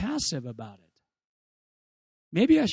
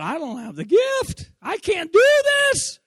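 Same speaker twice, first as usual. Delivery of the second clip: panicked; 12 semitones higher, 6 dB louder, slower than usual. Retold or imitated. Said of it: "I thought".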